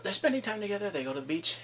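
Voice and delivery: ordinary talk, nothing marked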